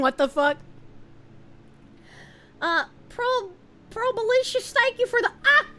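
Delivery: ordinary talk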